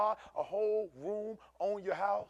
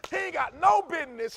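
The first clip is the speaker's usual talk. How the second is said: loud, high preaching take; 8 semitones higher, 7 dB louder than usual. Acted. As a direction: throw it out to the church. Repeated line, loud then quiet.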